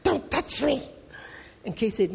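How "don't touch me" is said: snapping, a touch higher; mock irritated brush-off; lightly acted annoyed snap; annoyed brother. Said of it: "he's really annoyed".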